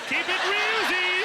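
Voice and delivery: high, loud hip sign-off; cool sign-off. Acted. God signing his letter with a cool, loud flourish. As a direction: toss it off like a rapper. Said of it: "that's how God writes letters".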